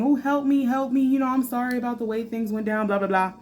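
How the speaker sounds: lightly mocking, high-pitched plea; half-hearted whiny begging, dismissively acted. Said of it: "coming to you begging and crying".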